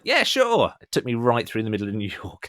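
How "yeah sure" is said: airy, much higher, carefree agreement; casual mock-naive yes; breezy yes. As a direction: toss it off like it's no big deal. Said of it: "And I was like".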